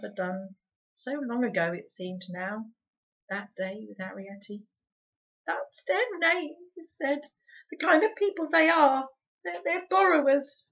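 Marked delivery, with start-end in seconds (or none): 5.5-10.7 high, loud tearful cry; boy's cry. A narrator's reading. Boy denying he is a thief, high tearful cry. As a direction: cry it out high, lips trembling.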